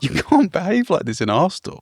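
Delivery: high-pitched, incredulous scolding; exasperated reproach. Retold or imitated. Reproaching the noisy guest in a hostel, exasperated.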